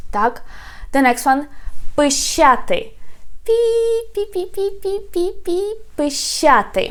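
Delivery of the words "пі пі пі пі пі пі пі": mouse squeak imitation. Shown as a demonstration. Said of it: "I do the sounds for you".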